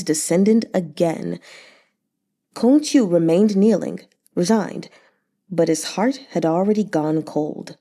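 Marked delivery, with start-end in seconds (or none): none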